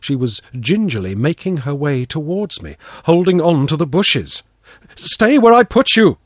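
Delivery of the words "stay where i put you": louder, higher, stern snap; sharp order. Acted. Father snaps at his daughter to stay put, sharp and loud. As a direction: bark the command at her. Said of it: "I said sharply".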